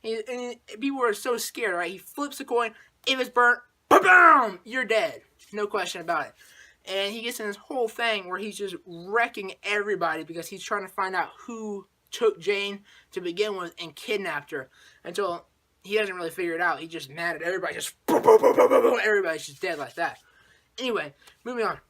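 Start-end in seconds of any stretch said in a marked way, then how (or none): 3.9-4.6 a loud, high "ba-bam" like a gunshot going off; loud gunshot sound. Retold or imitated. 17.7-19.0 loud, breathy run of "boom" like rapid gunfire; whispered gun sounds. Retold or imitated. Sound effects for a shooting spree.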